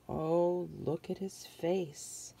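ordinary delivery, nothing marked

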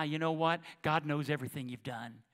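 ordinary delivery

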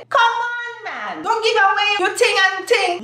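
high mock-exasperated plea; exasperated protest. Mock scolding over given-away jacket.

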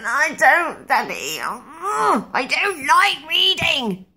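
high, slow whining protest; whining wail. Retold or imitated. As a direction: wail that you hate reading. Child wailing against reading.

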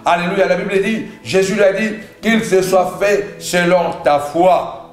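ordinary delivery

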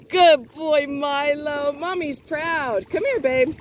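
high, sing-song praise for a good dog; doggy praise voice. Acted. Mom praising her dog after his swim, high and sing-song. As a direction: coo at the pup like a proud mom.

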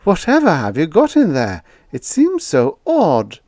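pry gently; curious landlady. A narrator's reading. Landlady pries about her tenant's box, high and curious.

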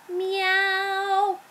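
high, drawn-out kitty meow; storybook cat noise; puppy meowing. A narrator's reading.

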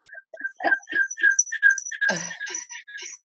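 short embarrassed laugh that trails off; sheepish laugh. Real, not acted. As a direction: laugh off the awkward ending. Nervous laugh ending her talk.

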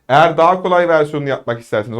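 ordinary delivery

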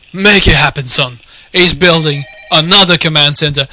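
ordinary delivery

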